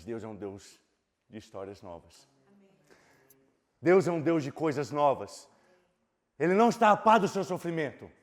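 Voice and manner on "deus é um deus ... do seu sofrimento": firm preaching close. Acted. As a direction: declare it to the congregation. Closing preaching proclamation.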